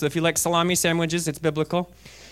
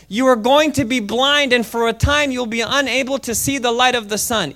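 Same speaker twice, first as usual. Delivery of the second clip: raised, forceful dramatic reading; stern pronouncement; 6 semitones higher, 3 dB louder than usual. A narrator's reading. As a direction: pronounce the curse as Paul would. Paul pronounces curse on sorcerer.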